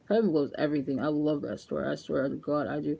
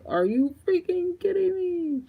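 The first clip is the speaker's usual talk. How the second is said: high, slow, stretched-out disbelief; mock disbelief; 14 semitones higher, slower than usual. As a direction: drag it out like you can't believe it. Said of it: "I was like".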